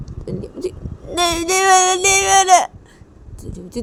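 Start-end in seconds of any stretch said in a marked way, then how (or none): 0.7-2.6 put-on much higher, sobbing child's voice; crying child. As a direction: sob the denial like a small girl. (रोती हुई बच्ची)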